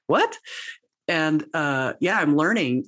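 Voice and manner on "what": sudden high squeak of disbelief; a high, startled yelp of surprise; shocked what. Retold or imitated. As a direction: blurt it out, caught completely off guard. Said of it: "And I was like".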